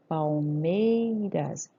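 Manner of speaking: "Palmeiras" very slow, long MEI; stressed syllable stretched. Shown as a demonstration. Teacher answering where stress falls, MEI held long in "Palmeiras". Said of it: "onde tá a sílaba tônica?".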